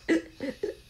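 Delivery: high, airless gasp like a cat fighting for breath; sharp gasping wheeze, a cat that can't breathe; imitated choking gasp. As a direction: copy the cat's choking wheeze. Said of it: "and he's like".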